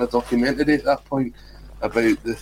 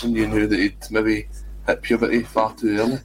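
usual speaking voice

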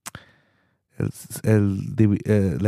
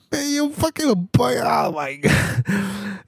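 outraged shout; 10 semitones higher, slower than usual. Retold or imitated. Telling the uncle what he thinks of him, a high outraged shout. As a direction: yell it as if you can't believe the man. His own outraged reply.

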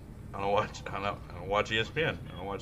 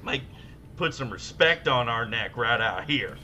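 hammed-up hype man; 3 semitones higher, 6 dB louder than usual. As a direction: ham it up like a radio sports blowhard. Hammed-up baseball trade rant.

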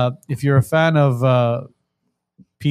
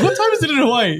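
high, laughing mock question; 13 semitones higher, faster than usual. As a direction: replay the question through laughter. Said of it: "I'm like".

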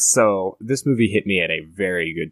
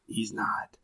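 retold whisper, no voice at all; whispered aside; 11 dB quieter than usual. Teacher whispering to the principal behind the kid's back. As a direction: lean in and breathe it secretly. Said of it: "he leans into the principal and goes".

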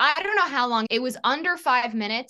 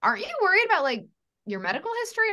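high, concerned roommate voice; worried roommate; 10 semitones higher, much faster than usual. Retold or imitated. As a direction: play the anxious roommate, pitched up. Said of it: "my roommate was like".

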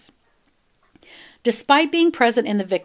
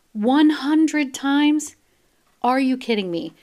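higher, very slow "One hundred times?"; incredulous; 4 semitones higher, slower than usual. Real, not acted. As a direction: stretch it out in pure disbelief. Incredulous at the stab count.

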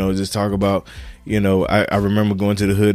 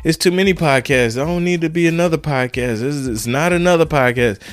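lightly voiced whiny doubters, pitched up; mocking naysayers; 6 semitones higher than usual. Podcaster mocks people who say there are too many podcasts, pitched-up mild sneer. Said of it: "some people".

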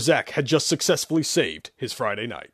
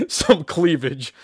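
laughing; 6 semitones higher, 4 dB louder, much slower than usual. Acted. Laughing leer, slow.

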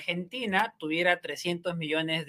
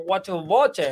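playful imitation of an English speaker; lightly put-on gringo English, ending on a rise; imitating an English speaker with a light English accent; mock English accent; 2 semitones higher, 4 dB louder, much slower than usual. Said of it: "que tiene que hablar como".

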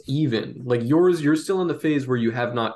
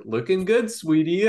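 grandma voice; 6 semitones higher than usual. Retold or imitated. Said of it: "like, your grandma on Facebook".

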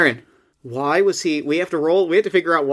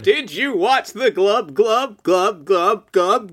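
gurgle the words like a bubbling machine; lightly voiced gurgling bot; 3 semitones higher, slower than usual.

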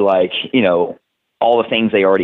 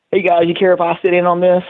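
a playful impression of the boss poking his head in; imitating Paul Heyman; 8 semitones higher than usual. Wrestler retells a Paul Heyman visit, in a higher mock voice. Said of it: "Paul Heyman picked his head in the room and going".

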